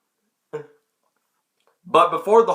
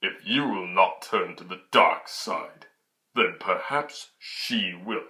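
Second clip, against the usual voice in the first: lower, slow, menacing Vader imitation; villain's low, slow threat; 6 semitones lower, 5 dB quieter, slower than usual. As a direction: threaten slowly and darkly. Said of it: "Vader's like".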